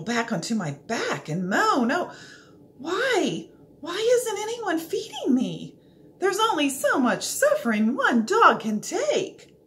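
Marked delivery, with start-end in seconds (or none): 1.9-9.6 whining lament. Acted. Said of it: "I cry and whimper and whine".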